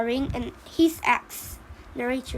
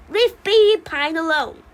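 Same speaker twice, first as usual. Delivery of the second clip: louder, forceful warning; protective command; 3 semitones higher, 6 dB louder than usual. Acted. Mama Pine defends Baby Pine from lodger, forceful. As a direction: order him away from your child.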